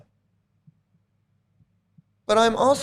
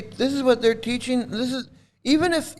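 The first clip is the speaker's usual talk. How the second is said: usual speaking voice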